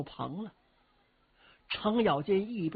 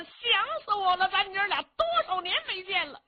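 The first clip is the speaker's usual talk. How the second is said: high, loud, rough-and-ready hero greeting his mother; a boisterous, high-pitched bumpkin hero; Cheng Yaojin's voice; 13 semitones higher, 4 dB louder than usual. Acted. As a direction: pitch it way up like a brash big-hearted fellow.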